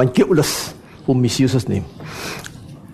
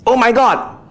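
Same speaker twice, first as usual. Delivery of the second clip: higher-pitched acted "oh my God" outburst; mock exclamation; 7 semitones higher than usual. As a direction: exclaim it as a mock casual oath. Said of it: "as a mere exclamation".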